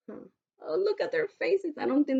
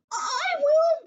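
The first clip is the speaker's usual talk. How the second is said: very high, louder eager cry; eager cat; 5 dB louder than usual. Acted. Hungry cat crying out for cake, very high and eager. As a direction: jump at the chance.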